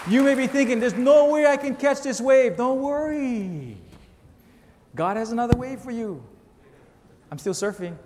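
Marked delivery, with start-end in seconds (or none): 0.1-6.2 raised and louder, pounding the point; full-force preaching. Acted. Preacher urges the church about facing waves, raised and louder. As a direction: preach it to the back row.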